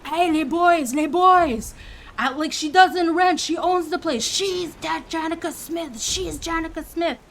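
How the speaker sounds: mock Quebecois worker yelling to his crew; hollering. Worker hollering to coworkers down the hallway, mock yell. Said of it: "he starts hollering, screaming down the hallway".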